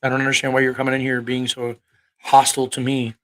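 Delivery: usual speaking voice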